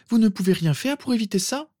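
put-on high-pitched pleading girl; jumping in. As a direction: press the professor urgently, voice high. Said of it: "bondit Andromeda". (question aiguë et pressante)

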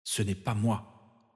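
soft, slow and fierce; "Ce n'est pas moi" slow and hushed, stress on "pas"; fierce inner thought. Acted. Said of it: "songea-t-il farouchement".

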